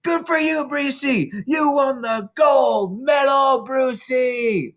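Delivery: put-on children cheering the winner. A narrator's reading. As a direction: cheer like the whole hall is on its feet. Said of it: "yelling and clapping and shouting".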